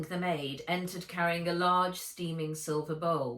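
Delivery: ordinary delivery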